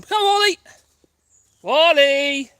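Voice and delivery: loud, high, drawn-out holler; calling the dog back. Real, not acted. Owner tells Wally he's going the wrong way, long loud call. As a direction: shout to a dog far off.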